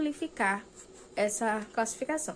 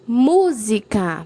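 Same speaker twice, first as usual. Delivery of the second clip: Música very slow, MU loud and stretched; stress on MU in Música; 12 dB louder, much slower than usual. Shown as a demonstration. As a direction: hit the first syllable MU of Música hard. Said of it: "Mu é a sílaba mais forte". (Música bem devagar, com MU forte e alongado)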